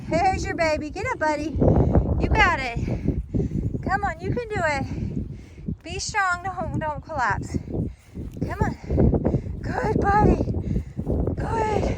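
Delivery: will the little calf to stay up; encouraging the calf. Real, not acted. Mom urging the newborn calf not to collapse, high and tender.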